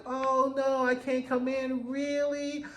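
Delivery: high, drawn-out coy voice; mock coy visitor. She teases the man at his door, mock coy. [name] teasing at Dom's door.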